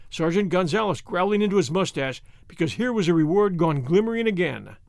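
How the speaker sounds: ordinary delivery, nothing marked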